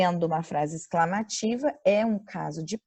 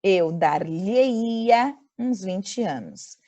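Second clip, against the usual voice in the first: very slow, stressing "dar-lhe-ia"; slow model sentence; much slower than usual. Shown as a demonstration. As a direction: read it out slowly for students. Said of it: "Então".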